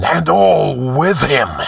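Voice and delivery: high, slow, furious cry; burst of passion. A narrator's reading. The sorcerer dooms all with him, crying out passionately. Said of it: "in a blind burst of passion".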